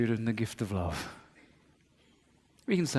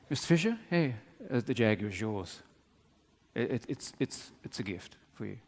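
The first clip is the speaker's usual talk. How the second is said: hesitant quiet; 5 semitones lower, 8 dB quieter than usual. Retold or imitated. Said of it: "And he says".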